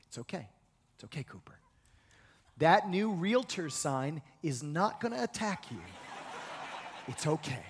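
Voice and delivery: gently calm the nervous dog; comforting. Retold or imitated. Pastor soothing his frightened dog on a walk, soft and low. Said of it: "I have to have a comforting".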